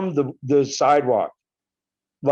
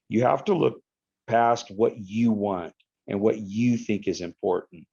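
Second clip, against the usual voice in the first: normal talking voice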